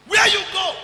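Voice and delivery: high shouted question; shouted high at the returnee; mock shout. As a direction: bark the question high and loud.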